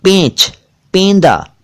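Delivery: "पेंच, पेंदा" as peynch, PEYN-da; correct close vowel. Shown as a demonstration. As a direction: say "पेंच, पेंदा" high, with a narrow ay. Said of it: "पैंच या पैंदा नहीं".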